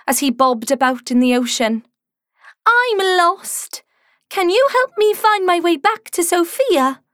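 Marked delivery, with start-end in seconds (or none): 2.7-7.0 high, pleading little voice; Sammy's lost voice. Acted. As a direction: ask for help like a lost little one. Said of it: "he said".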